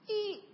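high thin imitated door creak; door squeak. Preacher imitating his bedroom door creaking open. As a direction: squeak like a slowly opening door. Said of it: "there's a little squeak".

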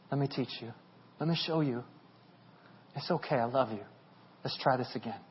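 very soft, low and tender; gentle Jesus. Acted. Jesus patiently reassures a failing follower, very soft and gentle. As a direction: reassure gently, almost under the breath. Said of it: "he's still like".

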